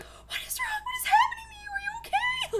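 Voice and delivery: put-on a parent freaking out over a fainting child; panicked parent. Imagining her child passing out, she cries out in panic. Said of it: "I'd be like".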